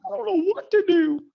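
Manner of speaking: high, loud, helpless mock whine; confused trader. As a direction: play a lost beginner wailing for help. Voicing a lost, confused trader.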